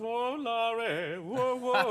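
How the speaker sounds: a hint of [name]'s easy crooner voice; lightly voiced [name]. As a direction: loosen up and swing it like Dean. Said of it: "[name]".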